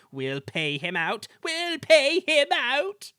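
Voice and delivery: vengeful, building to a high, loud squeal; slow, squeally threat; grumbling coaches. A narrator's reading. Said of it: "grumbled the coaches".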